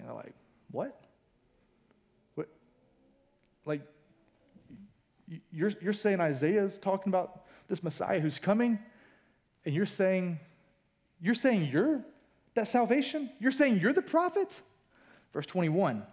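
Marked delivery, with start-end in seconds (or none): 0.7-3.8 halting, baffled "what?" with long pauses; stunned disbelief. Acted. Stunned synagogue crowd reacting to Jesus. 5.3-14.6 incredulous questioning. Acted. Crowd questions Jesus in disbelief.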